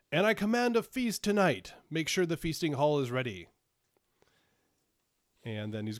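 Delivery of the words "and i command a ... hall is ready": higher, louder royal shout; shouted command. Acted. Monarch shouting after the departing seneschal, loud. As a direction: call after him across the hall. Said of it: "shouts after him".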